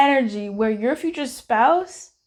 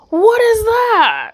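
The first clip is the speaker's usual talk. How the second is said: higher, louder, slow "What is that?"; a drawn-out, exaggerated "What is that?"; mock surprise; 11 semitones higher, 7 dB louder, slower than usual. Looking at the Five of Cups.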